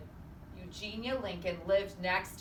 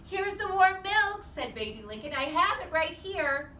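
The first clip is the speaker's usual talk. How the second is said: put-on eager Baby Lincoln; 9 semitones higher, 7 dB louder than usual. A narrator's reading. Baby Lincoln bringing the warm milk, high and eager. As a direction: offer the milk proudly.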